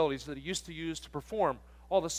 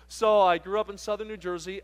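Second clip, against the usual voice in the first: usual speaking voice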